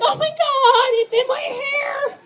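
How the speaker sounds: high, panicked wail; high horrified cry over puke in hair; panicked squeal. Retold or imitated.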